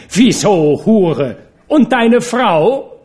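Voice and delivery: loud, high retort with a drawn-out finish; angry last word. A narrator's reading. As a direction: hurl the insult back, climbing to a shriek. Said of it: "um das letzte Wort zu haben".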